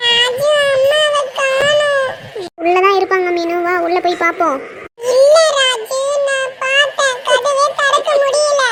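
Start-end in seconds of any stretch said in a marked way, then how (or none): 0.1-2.1 put-on high, slow crying little-kid voice; acted childish sobbing, drawn out; tearful child. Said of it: "என்ன மீனு அழுவுற?". 5.1-8.6 put-on high-pitched little-kid voice; high childish complaint, drawn out at the end; upset child. Crying child cannot find mother.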